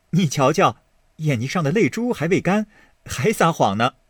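Baoyu, teasing. Acted. Baoyu teasing Daiyu about her tears, quick and playful. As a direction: catch her out with a fond laugh.

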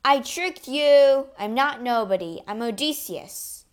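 gloating yell at the Cyclops. Acted. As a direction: shout the taunt back in triumph.

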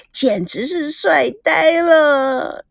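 slow, drawn-out swooning gush; dreamy swoon. Acted. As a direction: melt over him, stretching the words. Swooning over the man.

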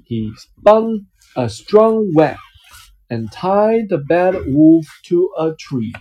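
lively picture-book reading voice; reading to a child. Acted. Dad reading a picture book to his child, storytelling voice. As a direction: read it out like a bedtime story for a little one.

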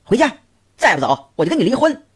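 shrill angry ultimatum; barked order. A narrator's reading. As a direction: threaten him sharply. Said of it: "一边喝道".

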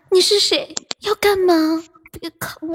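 coquettish. Shown as a demonstration. Co-host showing the player how to read it, coquettish. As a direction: show him a flirty reading, dissolving into a laugh. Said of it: "骚一点".

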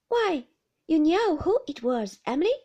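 put-on boy protesting playfully; young David. David protests to teasing Emily, boyish voice.

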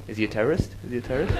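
low, hushed suspicion; wary audience thought. Acted. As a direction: murmur it as a secret suspicion. Voices audience's wary private thought.

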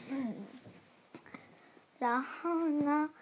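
plain reading voice

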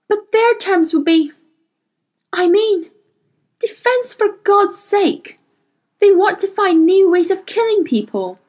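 high, indignant protest; heated refusal. Acted. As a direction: refuse in outrage.

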